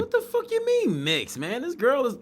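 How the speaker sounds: jumps very high in outraged shock; stunned disbelief. Real, not acted. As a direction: squeal it in real disbelief. Stunned by clip claim.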